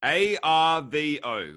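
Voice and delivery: slow, letter by letter; spelling it out. Shown as a demonstration. Host spells slang for guest, slow and separated. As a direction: spell it out slowly for a learner. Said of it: "spell that".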